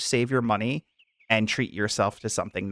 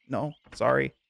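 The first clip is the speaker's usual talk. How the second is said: normal talking voice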